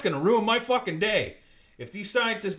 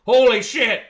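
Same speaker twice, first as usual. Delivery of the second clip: sudden loud burst of hype; real surprised yell; excited outburst; 7 semitones higher, 11 dB louder than usual. Real, not acted. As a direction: blurt it out, thrilled and loud.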